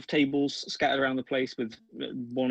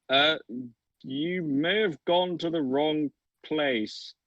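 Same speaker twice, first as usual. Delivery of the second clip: slow, higher, hesitant offstage voice; kitchen voice; 5 semitones higher, much slower than usual. Acted. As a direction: gently tell them they're lost. Kitchen voice calls out.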